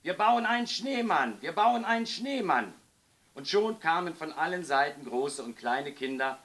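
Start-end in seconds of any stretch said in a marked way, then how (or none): 0.0-2.7 put-on higher, louder child's call; excited calling out. A narrator's reading. Lisa first down in the yard, calling out excitedly.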